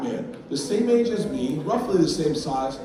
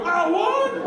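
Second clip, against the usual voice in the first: high incredulous holler; mock disbelief; 4 dB louder than usual. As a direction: copy the outraged shopper's question. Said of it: "I go".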